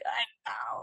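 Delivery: soft whispered mock sympathy; whispered commiseration. Viewer to grown men crying on video, whispered commiseration. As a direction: drop to a tiny whisper, imitating tearful sympathy. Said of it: "and I'm like".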